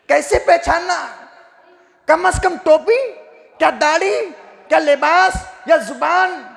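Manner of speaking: loud, high rhetorical questions; fervent challenge from the pulpit; impassioned preaching. Real, not acted. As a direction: throw each short question at the congregation, pausing between.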